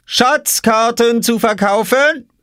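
very high, drawn-out vendor's cry; singsong high sales call. A narrator's reading. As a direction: hawk your wares slowly, pitched right up. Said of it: "rief Oskar hinter seiner Obstkiste".

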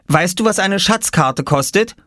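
put-on high child's voice, rising question; curious boy. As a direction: ask like a little salesman. Boy's question to the farmer. (neugierige hohe Jungenstimme)

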